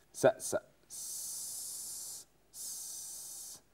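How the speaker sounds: long hissed s sounds for a level check; whistling s. Shown as a demonstration. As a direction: hiss a sharp whistling s, s, s. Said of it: "عايزين بس السين لو عشان بتصفر".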